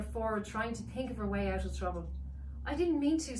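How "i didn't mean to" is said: put-on higher, louder boy's apology; sorry little boy; boy apologising, head down; sheepish boy. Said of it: "dipping his head".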